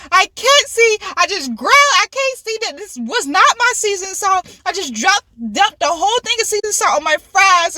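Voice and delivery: high-pitched mock fretting; worked-up retold lament; retold exasperated complaint. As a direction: narrate the mishap in high, dramatized exasperation. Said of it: "I'm narrating my whole life".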